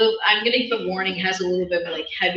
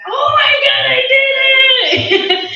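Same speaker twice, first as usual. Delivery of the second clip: loud, high, drawn-out squeal of joy; excited; 13 dB louder, slower than usual. Retold or imitated. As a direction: shriek it out like you can't believe it. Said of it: "I went like".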